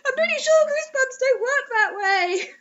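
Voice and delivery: high, laughing disbelief; giggly high-pitched protest; laughing aside. Real, not acted. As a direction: crack up at the writing. Mocking the story's logic.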